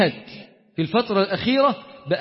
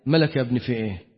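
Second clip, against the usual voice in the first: gentle father; 8 semitones lower, 4 dB quieter than usual. Retold or imitated. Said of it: "طبطب عليك".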